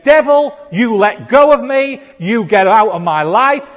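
loud, high declaration at the devil; defiant rebuke. Retold or imitated. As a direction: proclaim it forcefully, drawing out the last words. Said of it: "He would say".

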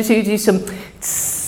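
soft breathy sea hiss through the teeth; storm wave hiss. Shown as a demonstration. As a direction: make the sound of waves crashing. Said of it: "maybe we can go to".

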